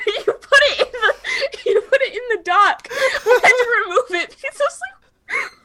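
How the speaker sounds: high laughing speech; words squeezed out through laughter. Real, not acted. Laughing at friend's joke.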